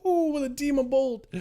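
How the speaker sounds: ordinary delivery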